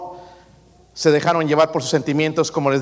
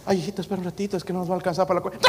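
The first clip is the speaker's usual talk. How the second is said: softer, fast, anxious coaxing; pleading parent; 5 semitones higher, 3 dB quieter, much faster than usual. Retold or imitated. Father begging his child to wait, soft and rushed. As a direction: hurry to calm the kid down.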